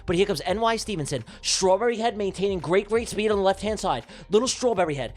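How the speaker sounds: excited race commentary. Acted. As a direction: call the ducks like a frantic race announcer.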